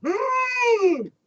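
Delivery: high gasping intake of breath; sharp, high mock gasp; mock crowd gasp. Crowd gasps at incantation.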